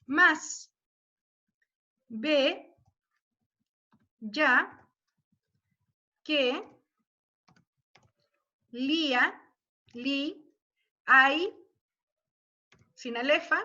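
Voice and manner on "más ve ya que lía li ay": "Más, ve, ya, que, lía, li, ay" counted out one syllable at a time with pauses. Shown as a demonstration. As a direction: spell out each metric syllable slowly and separately. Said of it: "separar las sílabas métricas". (separando sílaba por sílaba)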